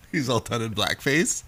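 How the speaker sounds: usual speaking voice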